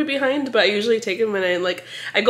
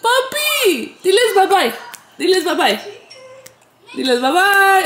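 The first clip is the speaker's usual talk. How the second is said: high, loud laughing squeal; delighted squeal; 9 semitones higher, 3 dB louder, slower than usual. Real, not acted. Mother squeals to her son, urging him to wave bye-bye.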